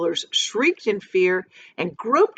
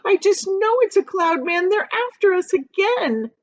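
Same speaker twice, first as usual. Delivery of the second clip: frightened cry; 13 semitones higher, 4 dB louder than usual. A narrator's reading. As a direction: shriek it out in fright. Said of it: "Miss Spider cried".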